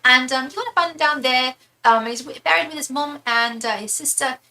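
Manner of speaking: high, sweet British lady voice; imitating a kindly, high-voiced British woman; British impression. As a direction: chirp it in a put-on British accent. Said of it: "that British impression".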